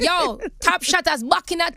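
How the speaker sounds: loud high excited quoting; excited movie quote. Retold or imitated. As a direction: shout the line with excitement. Reciting movie lines excitedly.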